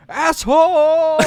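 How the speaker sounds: high, loud, drawn-out yell; mock yell. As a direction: shout it at the passing car. Movie character yelling at driver.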